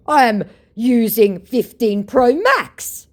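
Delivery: loud, high, slow mock brag; boastful flexing. Scammer shows off his phone to his target, boastful. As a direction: flex it grandly, word by word. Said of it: "he flexes".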